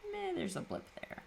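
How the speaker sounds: careless high voice. Retold or imitated. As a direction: toss it off carelessly. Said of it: "you more just go".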